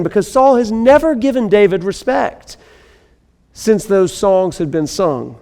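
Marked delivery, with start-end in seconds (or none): none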